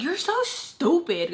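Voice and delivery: high, drawn-out mock outrage; mad outburst. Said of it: "I got so mad".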